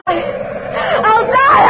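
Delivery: anguished scream. Acted. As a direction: let the despair burst out. Anne's anguish peaks in a scream.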